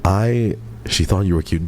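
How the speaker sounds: ordinary talk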